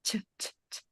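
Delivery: ch as unvoiced puffs of air; ch, just breath released in short bursts; aspirated ch. Shown as a demonstration. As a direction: repeat a soft, airy ch with no vowel. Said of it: "and then you put the air".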